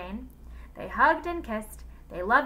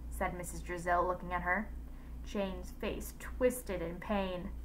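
usual speaking voice

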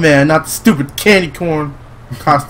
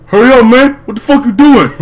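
loud, high-pitched urgent yelling; urgent shout; 8 semitones higher, 7 dB louder than usual. Acted. Character rushing his friend before they get caught, urgent shout. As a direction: shout at them to get moving.

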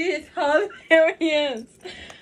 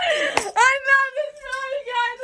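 breaking into giggles mid-sentence, pitched up; real laughter spilling through the words; laughing; 9 semitones higher, slower than usual. Real, not acted. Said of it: "That's why I'm laughing at Nick".

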